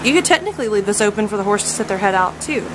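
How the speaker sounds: ordinary talk, nothing marked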